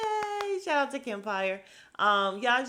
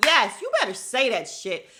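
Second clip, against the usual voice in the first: loud, raised hype-up; loud cheering; 4 semitones higher, 8 dB louder than usual. Retold or imitated. Cheering Garcelle on while watching, loud hype. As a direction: egg her on, loud.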